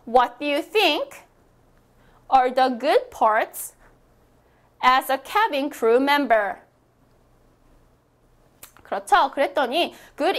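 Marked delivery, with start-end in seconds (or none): 0.0-6.5 broken into slow chunks with pauses; feed it phrase by phrase for repeating; teacher splitting the question into slow parts; slow phrase-by-phrase reading. Shown as a demonstration. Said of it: "소리 크게 내어주세요".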